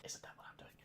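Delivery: unvoiced, very soft; whispered aside. Real, not acted. Catching himself being a hypocrite, whispered aside. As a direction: whisper it to yourself. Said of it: "self-aside".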